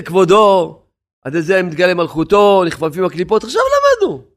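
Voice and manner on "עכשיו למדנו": high mock refrain. Preacher mocking the idea, high mock voice. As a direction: leap very high on עכשיו למדנו.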